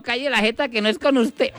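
high falsetto comeback; joke passenger retorting. Acted. Passenger telling off the taxi driver, high falsetto retort. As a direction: put the driver in his place in falsetto.

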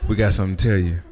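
ordinary talk, nothing marked

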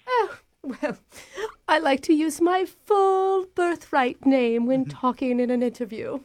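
put-on prim British accent; a put-on English lady; fake British accent. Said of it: "surprised by your accent".

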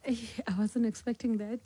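ordinary delivery

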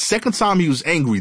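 ordinary talk

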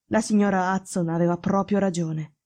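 usual speaking voice

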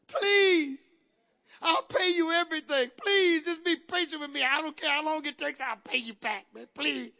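high opening cry, then rushed pleading that fades; desperate begging. Acted. Debtor pleads with his master for time to pay, desperate begging.

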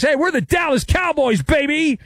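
high, brash mock swagger; cocky league boast. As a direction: puff up like an overconfident executive. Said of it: "the English Premier League thinks".

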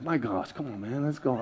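quiet, low impatient grumble; muttered impatience. Retold or imitated. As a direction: sigh it out under the breath. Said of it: "just being like".

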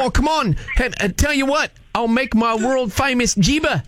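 broad put-on Aussie accent; Australian accent. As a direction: greet them in a hammed-up Australian accent. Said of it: "with this goofy accent".

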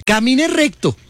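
imitating doctor. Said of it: "La rodilla".

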